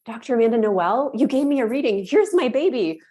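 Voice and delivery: bubbly delight at proving the reading right; excited quoted mother. Retold or imitated. Mother thanking the psychic, excited. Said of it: "she's like".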